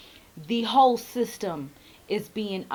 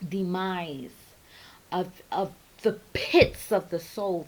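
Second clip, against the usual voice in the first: ordinary talk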